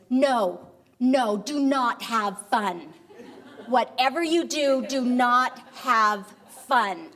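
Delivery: loud, slow, emphatic mock command; frantic correction. Husband hastily corrects himself to wife, emphatic. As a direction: hammer each word to take back a blunder.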